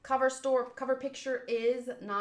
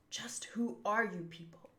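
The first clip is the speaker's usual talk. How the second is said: breathy unvoiced whisper; 7 dB quieter than usual. Reading the manga, whispering a character's line. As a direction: hush the question like a secret.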